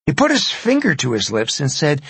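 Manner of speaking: usual speaking voice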